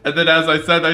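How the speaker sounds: normal talking voice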